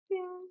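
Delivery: high, soft sung ding; switch ding. Retold or imitated. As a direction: chime it like a little bell. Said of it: "the switch just said".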